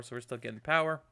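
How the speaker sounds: ordinary talk, nothing marked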